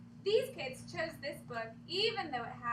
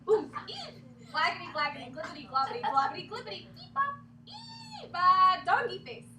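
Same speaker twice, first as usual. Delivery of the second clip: loud goofy nonsense babble; silly sounds; 3 semitones higher, 8 dB louder than usual. Acted. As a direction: belt out the gibberish playfully. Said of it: "make silly sounds".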